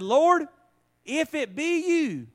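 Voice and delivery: high, loud, slow pleading cry; pleading Peter. Acted. Peter on the water calling to the Lord, loud and high.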